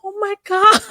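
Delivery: high squeal of alarm; panicked squeal while holding a baby. Retold or imitated.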